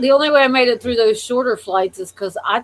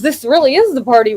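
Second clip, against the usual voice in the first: party cheer; 3 semitones higher, 7 dB louder than usual. Retold or imitated. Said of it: "And she goes".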